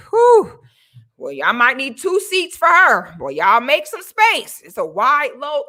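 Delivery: loud, slow mocking jeer; public mockery. Mother mocking her daughter in public, loud and jeering. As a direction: call it out for the whole room to laugh. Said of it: "say in front of everybody".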